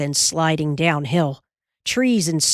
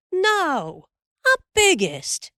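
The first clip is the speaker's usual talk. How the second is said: put-on high little boy voice; a small boy insisting, pitched up; 11 semitones higher, slower than usual. A narrator's reading.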